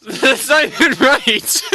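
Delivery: high voice through laughter; laughing. Real, not acted. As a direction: laugh as you speak.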